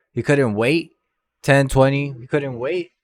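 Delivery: loud, punchy, questioning disbelief; incredulous complaint. Real, not acted. Storyteller on friend locked out five minutes, incredulous. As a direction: punch it out as if it makes no sense.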